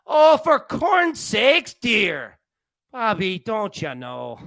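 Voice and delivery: slow, high Midwestern cartoon mom voice; drawn-out Midwest mom scolding Bobby; Bobby's World mom. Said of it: "the Bobby's World?".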